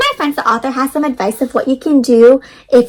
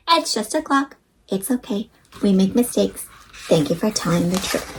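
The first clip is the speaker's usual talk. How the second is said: tender reassurance; 5 semitones lower, 7 dB quieter than usual. Acted. Grandma gently forgives Finn, soft and low. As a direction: lower and much softer, gentle grandma.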